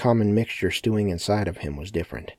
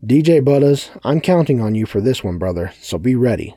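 loud, high call to the DJ; 6 semitones higher, 9 dB louder than usual. A narrator's reading. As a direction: call him out loudly. Sebastian calls out to the DJ.

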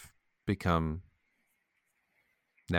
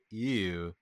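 usual speaking voice

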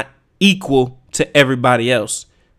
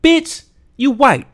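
exasperated retort; 11 semitones higher, slower than usual. Real, not acted. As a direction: fire it right back at them. Shouting back at excuse.

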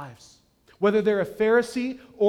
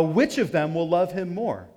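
usual speaking voice

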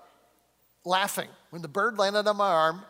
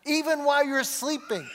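raised, higher preaching voice; emphatic preaching; 6 semitones higher than usual. Real, not acted. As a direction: drive the point home, higher pitched. Emphatic preaching, first take.

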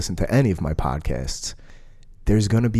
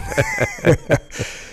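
burst of laughter. Real, not acted. Laughing at his own joke.

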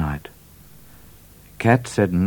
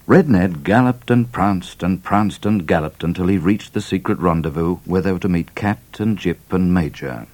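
usual speaking voice

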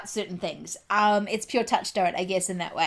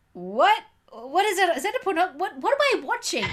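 high, baffled questions, half-asleep; mock confusion at the screen; bewildered; 9 semitones higher than usual. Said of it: "waking up at certain points and going".

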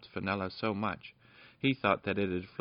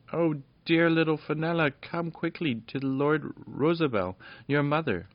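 high, eager little fairy voices; excited fairy voices; 8 semitones higher than usual. Acted. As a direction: fairies urging the princess home. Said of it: "cried all the fairies".

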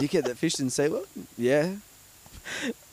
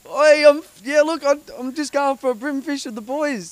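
mock innocent fisherman; 14 semitones higher, 5 dB louder than usual. Mate pretending to plead with security, high mock innocence. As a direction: play the cheeky bloke caught fishing. Acting caught fishing at SeaWorld.

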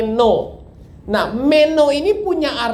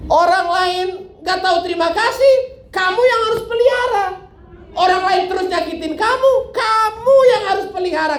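higher, insistent preaching at each kamu; building sermon; 6 semitones higher than usual. Acted. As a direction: press the duty on the flock, climbing. Preacher drives the point home.

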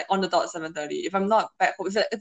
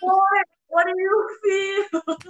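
mock echo; 11 semitones higher, 3 dB louder, much slower than usual. Mocking friend's call.

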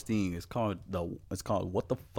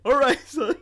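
laughing; 5 dB louder than usual. Real, not acted. High laughing.

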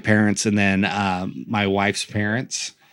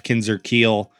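ordinary talk

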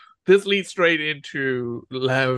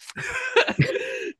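giggling squeal; high squealing laugh. Real, not acted. Laughing before retelling audience line.

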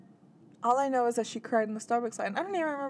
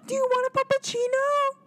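high, sweet mock barista voice; mock barista gush; 14 semitones higher than usual. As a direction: parody an overeager barista cooing. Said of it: "They want the barista to be like".

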